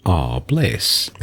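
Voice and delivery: ordinary delivery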